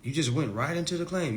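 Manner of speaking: ordinary talk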